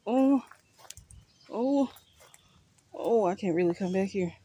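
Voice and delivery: startled high oh. Real, not acted. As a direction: jump back, startled. Startled by the spider.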